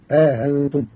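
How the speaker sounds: آهنتم with the second hamza eased between hamza and alif, slow; softened second hamza. Shown as a demonstration. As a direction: tashil bayna bayna, drawn out. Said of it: "بتسهيل الهمزة الثانية بين بين".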